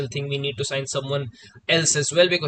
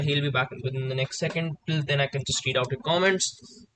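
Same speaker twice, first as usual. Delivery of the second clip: ordinary delivery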